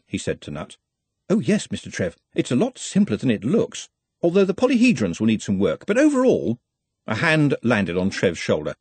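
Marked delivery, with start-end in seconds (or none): 1.3-6.6 higher, eager little scholar; mildly pedantic, pitched up; lightly voiced learned underling, reassuring. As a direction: reassure with confident technical detail. Voices Nutt in story.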